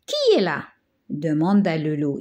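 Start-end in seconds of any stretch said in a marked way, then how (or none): 0.1-0.7 higher, sweet old-lady voice put on by a wolf; wolf faking the grandmother's voice, higher; imitating grandmother. A narrator's reading. Wolf fakes grandma's voice to fool the girl, higher and sweet. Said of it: "imitant la voix de la grand-mère".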